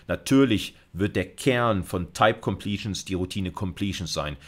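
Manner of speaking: usual speaking voice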